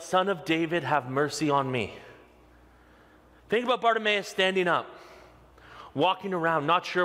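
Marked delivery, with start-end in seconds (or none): none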